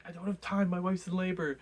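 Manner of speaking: high, loud, desperate retelling; panicked outburst. Retold or imitated. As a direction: blurt it out like a frantic new father. Levin desperate with shopkeeper.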